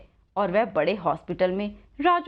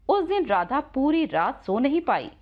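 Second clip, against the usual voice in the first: usual speaking voice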